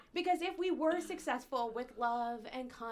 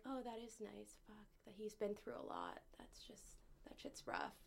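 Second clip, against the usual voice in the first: soft sympathy; 14 dB quieter than usual. Retold or imitated. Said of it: "part of me is sort of like".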